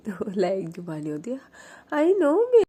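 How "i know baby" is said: put-on high, loud, sing-song "I know baby"; squealed sleepy reply. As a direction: squeal it out, half asleep and delighted. Mani answering in her sleep. (उनींदी, ऊँची, चहकती आवाज़ में)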